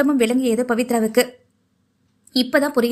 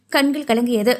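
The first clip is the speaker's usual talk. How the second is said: plain reading voice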